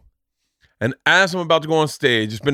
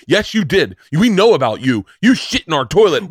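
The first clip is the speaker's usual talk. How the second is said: louder, insistent mock accusation; aggressive accuser; 3 semitones higher, 4 dB louder than usual. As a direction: imitate a man pressing his accusation hard. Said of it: "really aggressive, and he's like".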